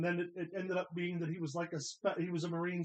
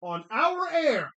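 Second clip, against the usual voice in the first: mock outrage; 4 semitones higher, 7 dB louder, slower than usual. Mock outrage at lying on air.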